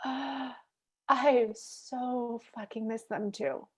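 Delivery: quiet, slow, moved confession; emotional. Retold or imitated. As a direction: let it ache as you admit it. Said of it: "I still get emotional".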